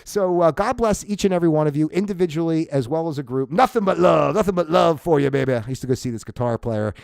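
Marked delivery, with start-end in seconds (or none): none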